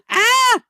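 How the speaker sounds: sudden very high mock shriek of panic; imitated panic scream. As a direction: squeal it like a startled panic noise. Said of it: "the most hilarious, like, panic noises".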